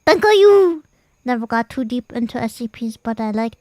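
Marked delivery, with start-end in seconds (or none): 0.0-0.8 high loud squeal of thanks; excited squeal. Real, not acted. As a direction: squeal it out, delighted. Squealed thanks after laugh.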